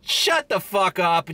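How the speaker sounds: ordinary talk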